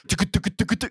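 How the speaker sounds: rapid stuttering motor rattle; imitated vibration noise. Storyteller showing the toy shaking, rattling noise. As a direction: mimic a toy shaking with quick mouth clicks. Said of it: "It's shaking".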